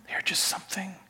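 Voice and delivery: hushed mock admiration, whispered; awed whisper. Onlooker admiring the hypocrites, an awed whisper. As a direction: breathe it out in mock awe. Onlookers gushing over Pharisees.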